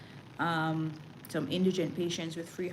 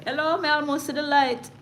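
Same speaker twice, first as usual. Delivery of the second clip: higher and louder, playing up nearly dying; hammed-up cry of near death; mock dramatic exclaim; 8 semitones higher, 8 dB louder than usual. Real, not acted. Telling viewers of the breathing test, hammed-up exclaim.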